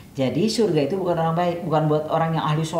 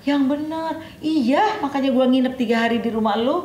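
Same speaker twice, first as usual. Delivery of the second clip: much higher, disbelieving "Yang benar"; high incredulous retort; 9 semitones higher than usual. Retold or imitated. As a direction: raise the voice in open disbelief. Companion's incredulous reply. (tidak percaya, suara jauh lebih tinggi)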